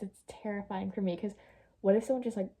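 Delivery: normal talking voice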